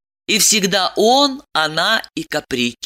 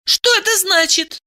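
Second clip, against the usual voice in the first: high-pitched demanding woman; bewildered woman character; 13 semitones higher than usual. Acted. Woman demands an explanation from her lover, bewildered. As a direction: ask it high, in disbelief. Diana questions him in disbelief.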